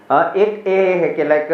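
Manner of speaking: ordinary talk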